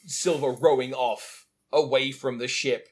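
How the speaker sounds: ordinary talk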